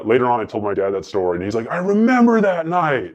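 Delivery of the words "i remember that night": dad's line pitched much higher and slowed. Retold or imitated. Retelling to a friend how his dad reacted, in a high slow voice. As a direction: play the dad, drawn out and amused.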